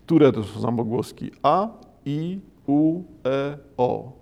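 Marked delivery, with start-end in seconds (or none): 1.4-4.1 each vowel "A, i, u, e, o" set apart and clearly sounded; Polish-like Japanese vowels. Shown as a demonstration. Lecturer shows Japanese vowels to Polish listeners, clear separate vowels. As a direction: recite "A, i, u, e, o" as pure Polish vowels, one by one. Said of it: "wymawianych tak, jak przed chwilą było słychać".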